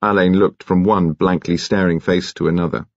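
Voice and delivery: ordinary delivery, nothing marked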